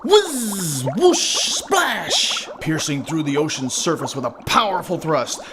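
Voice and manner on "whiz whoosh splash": high, loud whiz, whoosh and splash; exclaimed swooshing noises; acted sound effects. A narrator's reading. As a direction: sound out a flying cast and splash. Narrator voices the cast's sound effects.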